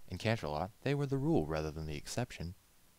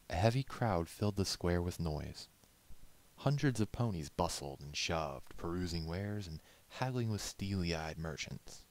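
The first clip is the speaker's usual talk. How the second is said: usual speaking voice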